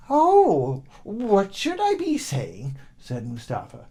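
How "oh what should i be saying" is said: put-on high, louder simple boy's question; puzzled boy. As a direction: ask it wide-eyed and high.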